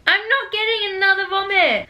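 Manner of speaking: loud, high, whiny refusal; loud protest. Real, not acted. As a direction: protest loudly, half laughing. Refusing after vomit bean.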